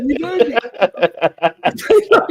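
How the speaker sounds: drawn out very slowly through laughter; laughing. Real, not acted. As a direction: stretch it out while laughing. Echo dissolving into laughter.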